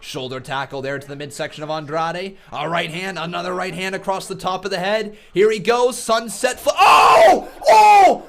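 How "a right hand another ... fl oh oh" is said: high, loud screaming call of the big move; live play-by-play. Real, not acted. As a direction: erupt as the finisher lands.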